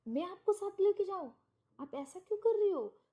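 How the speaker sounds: bewildered protest at her; a man aghast that she wants to come along; incredulous outburst. Retold or imitated. Narrator shocked by her request.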